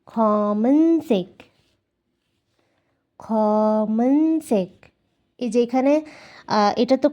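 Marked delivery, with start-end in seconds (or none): 0.1-4.7 খমেনসেক said very slowly; teacher modelling খমেনসেক slowly for learners. Shown as a demonstration. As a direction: stretch out খমেনসেক syllable by syllable. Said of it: "কালোকে বলা হয় খমেনসেক".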